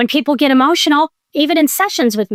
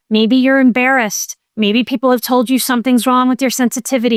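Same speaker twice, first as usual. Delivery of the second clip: ordinary talk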